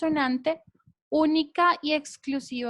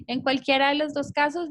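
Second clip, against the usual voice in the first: usual speaking voice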